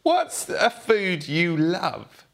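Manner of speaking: normal talking voice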